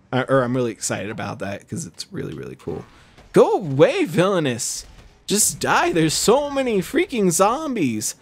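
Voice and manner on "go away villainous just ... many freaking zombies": higher-pitched exasperated whine. Real, not acted. Player swarmed by too many zombies, exasperated. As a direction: complain at the enemies, pitch jumping up.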